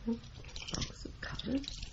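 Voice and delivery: slow breathy murmur, barely voiced; whispered to herself. Secretary checking the key ring, whispering to herself. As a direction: read the label under your breath, slowly.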